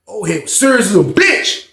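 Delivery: ordinary delivery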